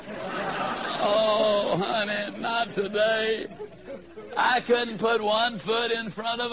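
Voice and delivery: slow, sing-song tired moan; a mild drawn-out plea to be let off; weary whine. Retold or imitated. Husband begging wife to skip the garage, slow weary sing-song whine.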